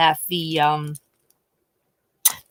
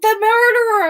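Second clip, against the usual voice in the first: high, loud announcement; dramatic reveal; 6 dB louder than usual. Retold or imitated. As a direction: drop the reveal. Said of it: "He's like".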